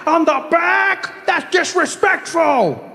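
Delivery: high, slow, indignant shouting; hammed-up patriotic outrage; mock indignation. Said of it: "People are like".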